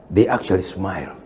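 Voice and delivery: ordinary talk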